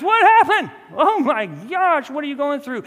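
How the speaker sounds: high, loud hammed-up shock; mock surprise. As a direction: exclaim as if utterly caught off guard, exaggerated. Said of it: "He's not surprised".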